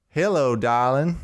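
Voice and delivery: hammed-up Southern drawl, slowed; a slick fake cowboy laying on the charm; fake country accent. A narrator's reading. A smooth-talking fake cowboy greeting the botanist in a hammed-up country accent. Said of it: "with an exaggerated country accent that sounded as fake".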